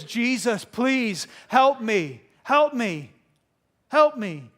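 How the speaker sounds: loud, slow begging, each plea falling; acted desperate plea. Preacher voicing a mother begging Jesus, loud plea.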